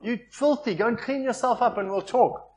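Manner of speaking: high, reproachful scolding of a dirty son. Retold or imitated. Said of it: "would think".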